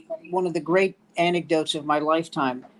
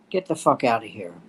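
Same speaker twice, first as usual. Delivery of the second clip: lower gruff dismissal; 7 semitones lower than usual. Retold or imitated. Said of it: "looks at him and goes".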